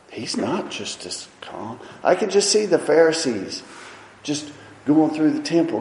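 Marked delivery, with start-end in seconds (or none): none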